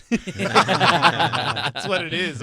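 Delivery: laughs through the words, slow and loud; cracking up mid-sentence; laughing. Real, not acted. Laughing at the turf joke.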